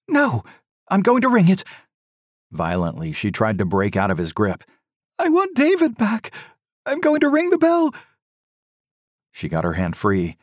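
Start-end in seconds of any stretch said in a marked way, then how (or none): 0.1-7.9 mother fights husband's grip, frantic; frantic insistence. A narrator's reading. Mother struggles against husband's grip.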